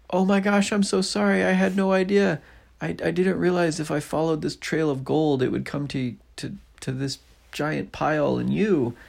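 put-on high, loud girl's voice; a flustered little girl apologizing; high, bright-eyed girl pleading; Nellie's voice.

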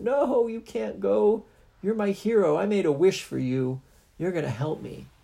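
put-on pleading little girl.

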